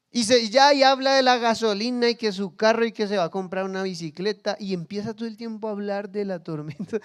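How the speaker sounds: normal talking voice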